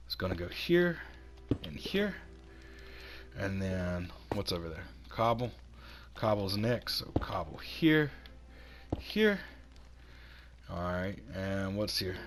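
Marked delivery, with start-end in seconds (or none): none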